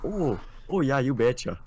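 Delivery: lilting mock-Minnesotan agreement; Minnesotan accent. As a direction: play up the Minnesota "oh yeah". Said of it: "that's not Minnesota".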